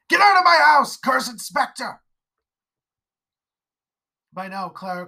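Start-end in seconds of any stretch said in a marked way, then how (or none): 0.1-2.0 driving out the specter with all his voice; screaming exorcism. Acted.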